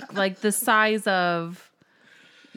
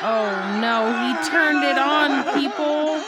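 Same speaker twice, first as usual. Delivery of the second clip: yelling over leaf blower; 6 semitones higher, 7 dB louder than usual. Real, not acted. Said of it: "can you hear the leaf blower going?".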